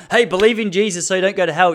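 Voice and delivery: hint of a street evangelist; preachy teacher. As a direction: lightly imitate a pushy preacher.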